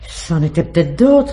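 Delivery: flustered loud excuse. Acted. Concierge caught lying, blurting a loud flustered excuse.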